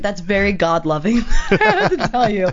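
a sarcastic quip broken by laughter; laughing comeback. Retold or imitated. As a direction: tease him through a laugh.

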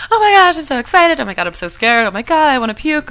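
frantic, high excited panic building to a loud wail; hammed-up nervous hysteria; mock freak-out. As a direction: gush and panic at once, ending in a loud cry. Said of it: "I'm freaking out".

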